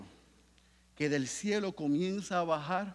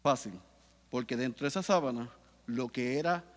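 normal talking voice

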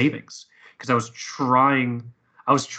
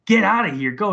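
raised, louder mock urging; retold urgent insistence; 8 semitones higher, 4 dB louder than usual. Coworkers sending bereaved man home, retold with loud urgency. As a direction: push him out the door, high and loud.

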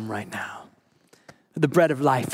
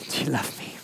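whispered Jesus question; 2 semitones lower, 7 dB quieter than usual. Retold or imitated. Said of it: "And he says".

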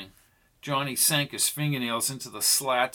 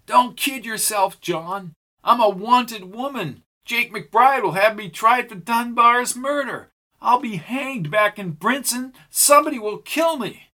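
higher, louder woman's voice, desperate; Pam, frightened outlaw woman; 9 semitones higher, 4 dB louder than usual. A narrator's reading. Pam tells Johnny she's a wanted woman, higher and desperate. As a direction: pitch it up as the scared, hunted woman.

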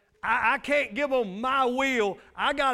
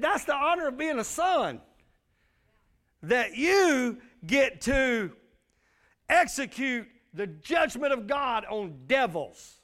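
preaching shout; 4 semitones higher, slower than usual. Real, not acted. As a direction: stretch it out and shout it to the church. Preacher peaks on key scripture.

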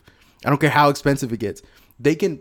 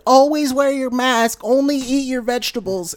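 Karen voice; 10 semitones higher than usual. Retold or imitated. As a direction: lecture like a prissy Karen. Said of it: "a lot of Karens who are like".

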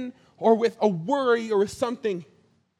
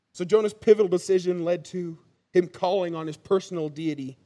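normal talking voice

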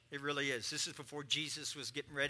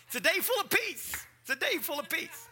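laughing; 13 semitones higher, 5 dB louder than usual. Acted.

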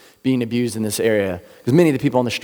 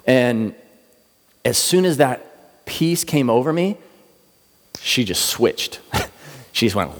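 ordinary delivery

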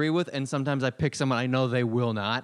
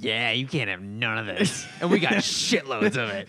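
gloating cook; 4 dB louder than usual. Pretend. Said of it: "guy just glaring at you".

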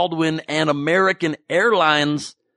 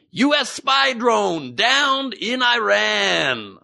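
very slow, high announcer headline; news headline read; 10 semitones higher, much slower than usual. Acted. Comic reads a news headline slowly, mock announcer.

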